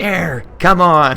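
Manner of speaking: high, drawn-out heckle; mock booing protest. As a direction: play an indignant viewer jeering at the screen. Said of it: "they're probably booing and throwing stuff".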